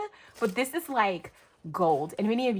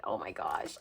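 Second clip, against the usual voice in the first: voiceless, tearful hush; overcome whisper; 9 dB quieter than usual. Real, not acted. Overcome while thanking Larissa.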